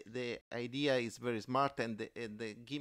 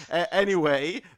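laughing; 5 semitones higher, 12 dB louder than usual. Real, not acted. Laughing after robot joke.

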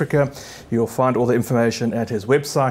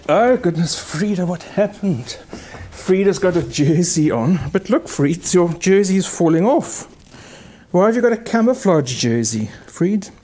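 higher, fussing sing-song voice; fond pet talk; 5 semitones higher than usual. Real, not acted. Pianist fussing over Frida's slipping jersey, high pet talk.

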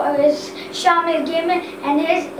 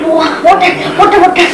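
mouth burning from spicy food; 9 dB louder than usual. Real, not acted. Gasping for water after spicy bite.

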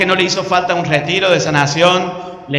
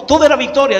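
usual speaking voice

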